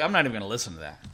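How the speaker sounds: quick, low, quiet brush-off; rushed, low-voiced refusal; mock dismissal. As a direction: imitate someone refusing advice under their breath, fast. Retold refusal of advice.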